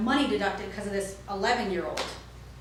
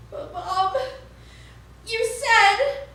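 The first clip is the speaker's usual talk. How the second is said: high, rising whine to mum; whiny kid; 11 semitones higher, 5 dB louder, much slower than usual. Retold or imitated. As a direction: plead with mum, betrayed. Boy whines to his mother.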